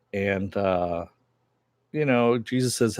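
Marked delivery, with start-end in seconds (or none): none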